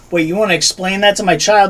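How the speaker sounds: ordinary talk, nothing marked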